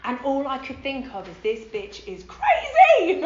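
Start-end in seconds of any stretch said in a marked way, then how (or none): 1.4-3.2 slow, loud, higher and laughing; amused disbelief; laughing thought. Retold or imitated. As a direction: drag it out through laughter. Thought about the sponsor.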